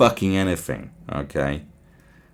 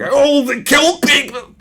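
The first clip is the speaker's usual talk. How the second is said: mock outrage; 5 dB louder than usual. Mocking hysterical public reaction.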